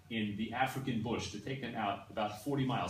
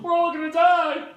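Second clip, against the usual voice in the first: mock panicked shout, much higher and louder, slowed; 15 dB louder than usual. Teacher contrasting panic with calm wording, mock panicked shout. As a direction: wail it out like it's the end. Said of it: "Mildly inconvenient is different than".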